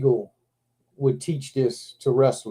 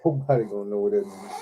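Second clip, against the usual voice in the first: real laughing; 5 semitones lower, 4 dB quieter than usual. Real, not acted. Joking about the labelled box.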